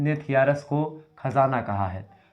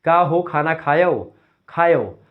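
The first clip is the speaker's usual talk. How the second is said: Awadhi dialect greeting, louder and slow; Awadhi village dialect; 2 semitones higher, 6 dB louder, slower than usual. Shown as a demonstration. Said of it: "तो अपने यहाँ पर क्या होता है".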